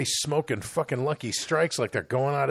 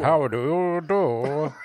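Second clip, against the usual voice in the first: slow, shouted, overly formal how do you do; hammed-up prim greeting; much slower than usual. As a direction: drag it out like a stiff 1950s gentleman. Exaggerates the sitcom greeting.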